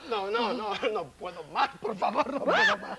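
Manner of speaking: pleading rising into a high squeaky wail; desperate begging. Acted. Desperate man begs to be spared. (súplica desesperada que sube a un chillido agudo)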